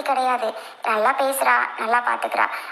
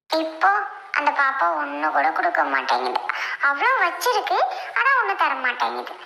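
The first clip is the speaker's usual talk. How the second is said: put-on higher-pitched little child's voice; high child voice, making excuses; pleading child; 3 semitones higher than usual. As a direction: explain it to grandfather like a small child. Child explains crying to grandfather.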